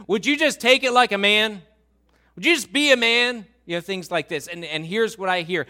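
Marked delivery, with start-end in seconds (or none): 0.1-3.5 loud, high, exasperated shout; military dad. Retold or imitated. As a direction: bellow it like a drill-minded father. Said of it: "he would say things like this".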